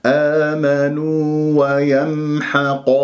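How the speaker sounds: آمنوا ويمحق stretched, letting the م resonate; measured tajweed model of آمنوا ويمحق; slow recitation. Shown as a demonstration. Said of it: "الميم الساكنة".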